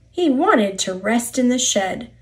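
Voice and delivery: usual speaking voice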